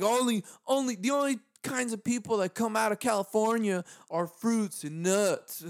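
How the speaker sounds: a hint of a smug Southern buddy; mock punchline. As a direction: land the zinger with relish. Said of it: "This is a funny joke. They're like".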